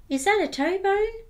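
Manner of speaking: very high, puzzled little skeleton voice. Acted. Little skeleton unsure which bone this is, very high. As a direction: squeak it like a confused small child. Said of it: "the little skeleton said".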